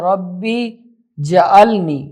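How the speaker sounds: ربی جعلنی said as rabbi ja'alni, jeem voiced with a vowel; wrong reading. Shown as a demonstration. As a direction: demo of the mistake, ربی joined to جعلنی. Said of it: "پہلی غلطی یہاں یہ کرتے ہیں". (غلط تلفظ: ربی جعلنی)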